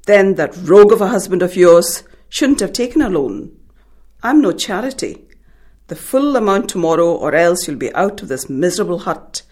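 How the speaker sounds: loud, angry roar at the mother; roaring moneylender. A narrator's reading. Moneylender roaring his threat at the begging mother. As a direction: bellow the threat at her. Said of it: "he roared".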